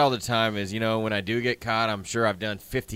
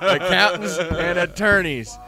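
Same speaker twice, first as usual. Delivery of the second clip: slow, higher and louder punchline; 7 semitones higher, 7 dB louder, slower than usual. Acted. Telling friend the joke's end, slow emphatic punchline. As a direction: land the punchline slowly.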